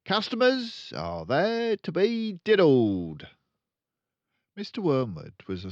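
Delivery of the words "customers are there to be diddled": loud, high, scoffing dealer; cynical father. Acted. Father lecturing Matilda, loud cynical scoff. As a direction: dismiss honesty with a sneer. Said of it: "the father said".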